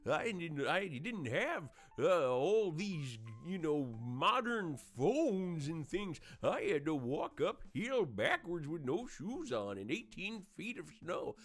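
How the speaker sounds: lightly voiced grumpy old man, slow; hint of an old man telling hardship tales; mock old-timer, slowed down; old man's version. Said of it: "the old man's version".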